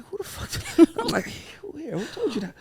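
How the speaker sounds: high, incredulous questioning; mock bewilderment. Dad baffled by his daughter's new word, high mock disbelief. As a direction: imitate a baffled dad, pitched right up.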